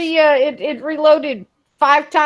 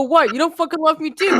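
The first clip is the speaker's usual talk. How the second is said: Scotty impression; 3 semitones higher than usual. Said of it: "would talk like Scotty".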